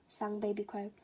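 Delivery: usual speaking voice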